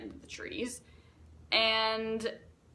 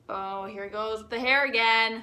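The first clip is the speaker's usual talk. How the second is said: ordinary talk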